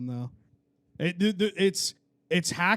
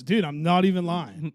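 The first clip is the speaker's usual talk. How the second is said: ordinary talk, nothing marked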